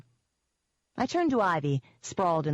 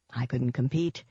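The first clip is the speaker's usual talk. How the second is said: ordinary delivery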